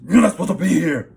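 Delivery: a stranger blocking the way, shouting a warning at the narrator; loud booming voice. Retold or imitated. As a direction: bark it like a threat from a stranger. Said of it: "His voice was very deep. It was loud".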